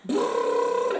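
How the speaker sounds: vibrate the lips in a motor-like brrr; buzzing lip-trill warm-up note. Shown as a demonstration. Coach shows pupils the little motor, lip trill. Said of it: "c'est faire vibrer les lèvres".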